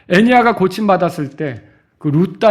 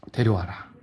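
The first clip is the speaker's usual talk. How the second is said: calm command; 11 semitones lower, 10 dB quieter than usual. Acted. Jesus voiced, low calm.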